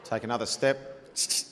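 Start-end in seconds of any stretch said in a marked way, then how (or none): 1.1-1.4 imitated hiss. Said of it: "next minute I hear this".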